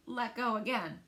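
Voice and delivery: ordinary delivery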